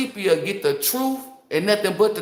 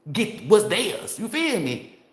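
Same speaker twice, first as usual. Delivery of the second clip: normal talking voice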